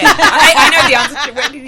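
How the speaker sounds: loud, high, fast laughing outburst. Real, not acted. Woman eager to answer for the group, laughing. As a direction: jump in loudly through laughter.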